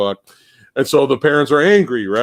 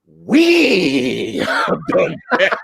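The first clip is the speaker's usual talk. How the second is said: mock De Niro line; 4 semitones higher, much slower than usual. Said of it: "De Niro goes".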